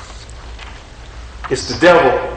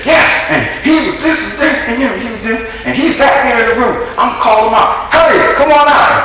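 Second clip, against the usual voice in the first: high, laughing and building to a shout; high excited wife calling her husband out, laughing; 11 semitones higher than usual. Retold or imitated. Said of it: "and she said".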